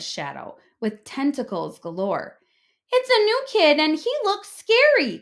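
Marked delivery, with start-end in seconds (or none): 2.9-5.2 high, loud, panicked warning; scared cry. A narrator's reading. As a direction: alarm the whole playground. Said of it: "cried Joey Mackerel".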